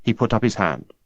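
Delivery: ordinary delivery, nothing marked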